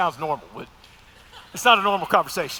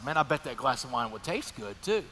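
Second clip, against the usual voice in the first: quiet inner thought; 6 semitones lower, 8 dB quieter than usual. Retold or imitated. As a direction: lower and softer, tempted musing. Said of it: "I'm thinking to myself".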